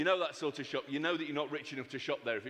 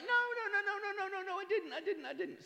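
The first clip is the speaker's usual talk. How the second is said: frantic denial. Acted. Husband denying to his wife he's drunk, high and fading. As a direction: protest in a high panicky squeak that fades.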